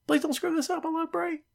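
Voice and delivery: high, soft fretting voice; anxious fan worrying. Retold or imitated. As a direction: worry aloud like a nervous fan.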